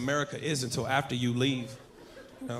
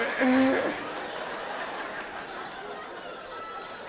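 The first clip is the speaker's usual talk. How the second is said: silent wheezing laugh; 8 semitones higher, 7 dB quieter than usual. Real, not acted. Laughing at own bit.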